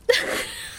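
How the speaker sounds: a bright little flattered laugh; short giggle. Acted. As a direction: laugh lightly at the idea. Narrator flattered by suitors.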